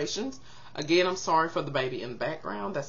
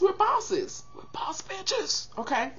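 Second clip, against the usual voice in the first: proud boast; 12 semitones higher, 3 dB quieter, slower than usual. Real, not acted. Bragging about Taurians.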